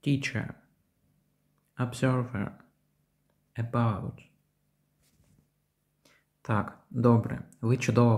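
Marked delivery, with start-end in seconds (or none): 0.1-4.3 "Teacher", "Observer", "About" with a schwa between E and A; unstressed neutral vowel. Shown as a demonstration. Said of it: "Цей звук середній між звуками Е та А". (ненаголошений звук між Е та А в "Teacher", "Observer", "About")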